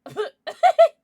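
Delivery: a sudden loud shrieking laugh; loud burst of laughter. Real, not acted. Loud laugh that startles the stream chat. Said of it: "That was, like, really loud".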